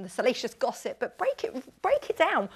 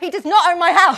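loud, high, outraged denial; indignant protest; 8 semitones higher, 16 dB louder than usual. Real, not acted. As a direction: shout it down in comic indignation. Protests the house ownership claim.

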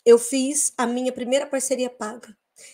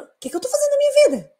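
excited outburst; 13 semitones higher, 4 dB louder, faster than usual. Retold or imitated. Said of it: "aí eu falei".

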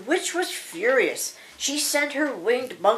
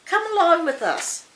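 high, inviting call; eager cry; 9 semitones higher, 4 dB louder than usual. Acted. Dorothy invites the tin woodman along, crying out eagerly. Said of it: "cried Dorothy".

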